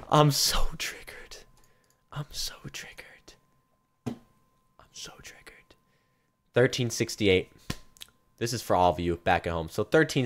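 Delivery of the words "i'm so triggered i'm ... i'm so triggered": soft, slow, defeated whisper. Real, not acted. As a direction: breathe it out, fading away. Whispering after bad unbox.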